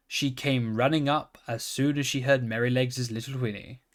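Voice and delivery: ordinary delivery, nothing marked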